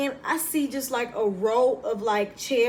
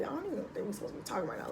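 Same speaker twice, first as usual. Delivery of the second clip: softer and fast, barely voiced worry; fast nervous undertone; 3 semitones lower, 12 dB quieter, much faster than usual. Anxious recruit mutters to herself on the bus, quick and soft. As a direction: rush it out under your breath. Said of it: "I was just like".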